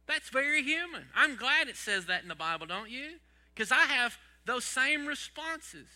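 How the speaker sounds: loud, high burst of feeling; excited preaching. Real, not acted. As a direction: lift the room with it. Preacher bursts out about Bible.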